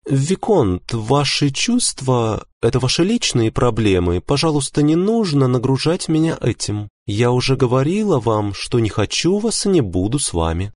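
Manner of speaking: high, composed woman's voice; calm wife. A narrator's reading. Wife rejecting the viscount's feelings, calm. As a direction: reject him evenly in a high female voice. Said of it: "затем спокойно сказала".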